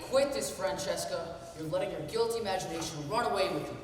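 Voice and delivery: loud, firm rebuke; stern scolding. Acted. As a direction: snap her out of it. Husband scolds Francesca.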